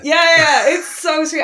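loud, high burst of delight; excited, loud agreement. Real, not acted. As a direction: gush over it, high-pitched. Delighted by co-host's Minnesotan impression.

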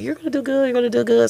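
high-pitched chorus of encouragement; imitated well-wishers. Comic voicing everyone before the special, high and encouraging.

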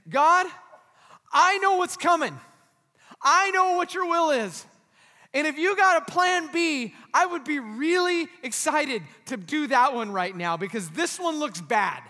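loud, high, urgent prayer; impassioned paraphrased plea to God; crying out to God. Acted. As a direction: plead for another way at full voice. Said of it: "he cried out to God".

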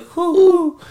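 high imitated owl hoot. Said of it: "all they made was like the hoo hoo".

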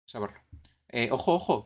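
ordinary talk

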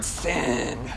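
"Sin." hissed low with a long s; drawn-out s like a snake. Shown as a demonstration. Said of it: "Like in the garden".

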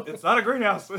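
says it through real laughter; laughing along with a friend's joke. Real, not acted. Echoing a co-host's punchline while laughing.

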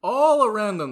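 loud narration. A narrator's reading. Narration opens loud, then stumbles.

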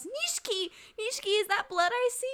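high, frantic acted cry of alarm; alarmed Kimi.

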